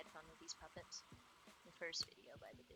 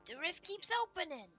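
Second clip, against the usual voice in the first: high, loud theatrical puppet; over-the-top high-pitched alarm; alarmed puppet character; 12 semitones higher, 13 dB louder than usual. Acted. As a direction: proclaim doom loudly, drawing out the big words. Plays alarmed puppets in a game.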